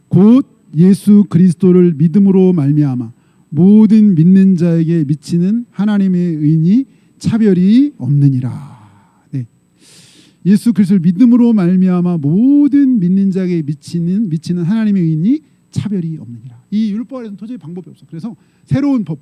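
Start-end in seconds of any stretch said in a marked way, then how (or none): 0.1-9.3 loud, slow congregational Bible reading; drawn-out, solemn recitation with a falling close; scripture recitation. Acted. Leads scripture reading aloud. 10.4-16.6 loud, slow congregational Bible reading; drawn-out, solemn recitation with a falling close; scripture recitation. Acted. Repeats the verse aloud.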